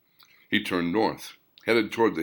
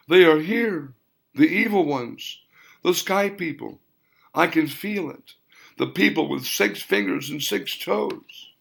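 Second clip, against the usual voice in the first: higher, lightly voiced old woman in dread; frightened grandmother; 8 semitones higher than usual. A narrator's reading. Grandmother, eyes puffy from weeping, warning Williams in higher dread. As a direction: warn him of the sky people.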